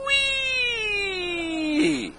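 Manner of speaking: long high sliding wheee; a drawn-out high whee fading off. Pretend. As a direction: stretch the squeal out long. Drawn-out laser squeal.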